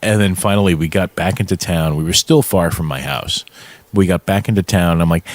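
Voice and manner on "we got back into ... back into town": normal talking voice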